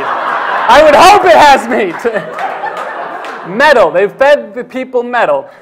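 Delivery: slow, loud, laughing disbelief. Real, not acted. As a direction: crack up while insisting. Laughing disbelief at meat joke.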